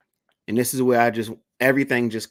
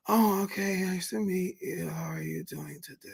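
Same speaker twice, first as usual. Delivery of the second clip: higher, much softer polite mother's voice; imitating a polite mom; 6 semitones higher, 8 dB quieter than usual. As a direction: play the mom as sweet and soft-spoken.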